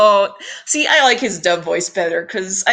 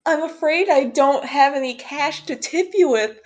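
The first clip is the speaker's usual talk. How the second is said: high, coy damsel act; flirtatious customer; 5 semitones higher than usual. Acted. Customer with no cash tips Mister Match, coy and high. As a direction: play a hammed-up helpless customer, pitched high.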